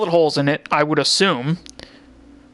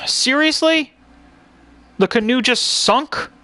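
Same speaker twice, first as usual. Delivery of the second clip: raging gamer; 8 semitones higher than usual. Real, not acted. Canoe sinks, gamer rages.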